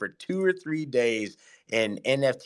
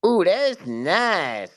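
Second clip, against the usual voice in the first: drawn-out admiration; 8 semitones higher, 5 dB louder, much slower than usual. Acted. Echoing admiration of gold.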